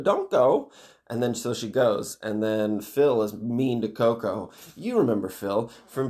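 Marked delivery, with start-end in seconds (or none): 0.0-0.7 high, loud, drawn-out mock warning; bus driver warning. Bus driver warns girl leaving the bus, hammed-up high warning. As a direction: swoop up high on a warning call. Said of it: "the bus driver's like".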